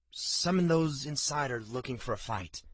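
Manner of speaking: plain reading voice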